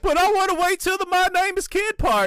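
very high, fast mock whine; hammed-up high-pitched protest; whining wrestler. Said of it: "And he's like".

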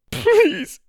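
pleading. A narrator's reading. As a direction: beg him through tears. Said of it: "Ma pleaded".

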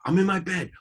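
high, upset protest; imitated teen yelling back; defensive. Acted quarrel, daughter answers.

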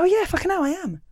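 mock dawning shock, a light hammed-up jolt; sudden realisation. Comic realising her own mistake, mock surprise. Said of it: "You go".

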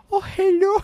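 high, put-on Chinese accent greeting; mock Chinese accent. Friend imitating the lady in a Chinese accent. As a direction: chirp it in a fake Chinese accent. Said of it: "Just in a Chinese accent".

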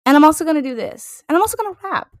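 high, loud, quick brag; imitating the singer. Fan voicing a singer announcing every genre she'll do, imitating her. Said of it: "She was like".

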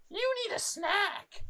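high, slow mock mumble of a strange woman; high drawn-out mock of the airport woman; mimicking a muttering stranger. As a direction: imitate her chanting it to herself, way up high. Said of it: "like, over and over again".

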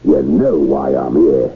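put-on low gruff old sailor voice; gruff old fisherman. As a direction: speak low like a menacing sea captain. Captain demanding his money.

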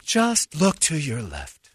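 slow, sing-song princess voice; a light imitation of a Disney princess nurse; cartoon princess. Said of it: "sounds like a Disney princess".

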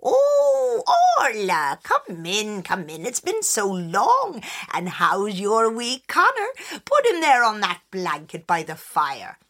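a hint of a kindly old woman; lightly voiced welcoming old woman; old wise woman. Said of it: "the wise woman".

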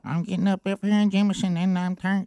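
lightly voiced caller chanting a catchphrase; Gary impression. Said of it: "I wanted to hear Gary do the, uh, I'm Turned song".

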